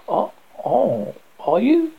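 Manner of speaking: mildly scornful, with a high rising question; fine scorn. A narrator's reading. Narrator as the host answering his visitor, with fine scorn. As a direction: throw it back with lofty mock surprise. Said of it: "I said with fine scorn".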